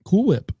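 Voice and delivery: mimicking the odd "Whip" in "Cool Whip"; slow mock copy of "Cool Whip", pitch jumping up; imitated mispronunciation. Co-host showing the offender his odd "Cool Whip", imitating it. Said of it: "For pronouncing it like that".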